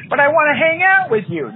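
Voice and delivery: put-on high pleading child voice; whiny kid. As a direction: beg like a clingy little kid.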